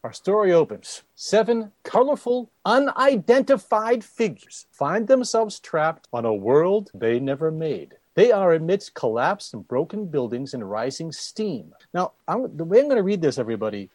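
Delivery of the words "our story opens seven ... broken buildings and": put-on old sci-fi announcer. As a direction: boom it out like a vintage radio serial. Dramatic opening read of comic.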